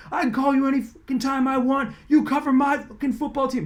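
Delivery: high mock shouting coach; retold yelling. Coach yelling at the reporter on the phone, mock yell. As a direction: snap back like an angry coach. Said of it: "We're screaming at each other".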